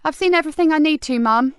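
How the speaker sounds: fast, eager answer; detective hiding her excitement from the family; quick reply. A narrator's reading. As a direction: rush it out, keen.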